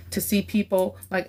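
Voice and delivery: normal talking voice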